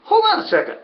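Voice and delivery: sudden realization. Real, not acted. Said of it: "I wasn't dreaming that".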